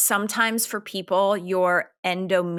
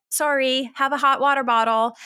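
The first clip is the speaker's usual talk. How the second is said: higher-pitched mock brush-off; mock dismissive; 7 semitones higher, 3 dB louder than usual. Doctors brushing off her mom's horrible periods, mock dismissive. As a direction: imitate someone offering token sympathy.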